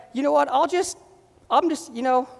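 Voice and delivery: a hint of a breezy shrug voice, high; mock casual believer. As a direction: toss it off lightly, like you can't be bothered. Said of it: "you can't be like".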